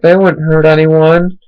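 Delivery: high, slow mock owner voice; dog owner. Owner excusing her dogs, high slow mocking voice. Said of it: "she's like".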